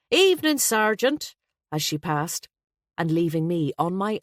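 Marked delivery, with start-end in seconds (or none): 0.1-1.3 high, loud, cheery hello; cheery greeting. A narrator's reading. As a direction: sing out a breezy greeting in passing. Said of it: "greeting Malloy with a cheery".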